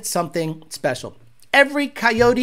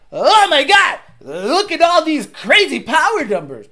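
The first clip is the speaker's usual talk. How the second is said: much higher, louder fake amazement; mock excitement; 7 semitones higher, 4 dB louder than usual. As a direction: ham up a hyped salesman at the dyno. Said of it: "they're like".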